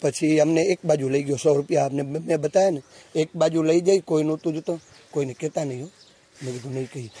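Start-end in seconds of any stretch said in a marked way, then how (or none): 5.1-7.1 softer, lower conspiratorial tone; a hint of a hushed promise; hushed secret. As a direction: keep it down as if nobody should hear. Secret bribe deal behind stall.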